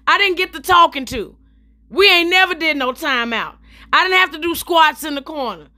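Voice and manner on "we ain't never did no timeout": usual speaking voice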